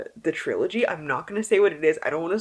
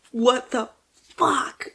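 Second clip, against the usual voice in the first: stunned disbelief; 6 semitones higher, slower than usual. Real, not acted. Stunned by the book.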